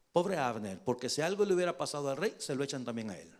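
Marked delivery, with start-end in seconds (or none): none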